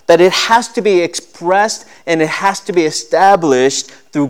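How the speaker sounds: high, loud preaching voice; impassioned preaching. Acted. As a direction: preach it out to the whole church. Preaching at full force.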